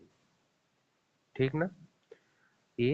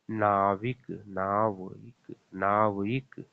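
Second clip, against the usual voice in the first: slow sounded-out word; much slower than usual. Shown as a demonstration. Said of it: "आव आ रहा है बीच में".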